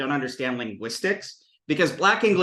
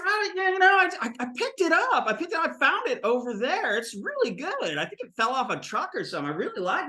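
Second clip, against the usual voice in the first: high, hesitant then rambling character voice; joke cheese eater; 9 semitones higher, faster than usual. Acted. Cheese eater explaining his find, high-pitched and rambling. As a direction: babble an excuse in a raised voice.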